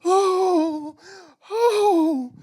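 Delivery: high, drawn-out imitated wail; hammed-up loud sob, sliding out on "oh"; mock sobbing wail. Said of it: "she's sobbing".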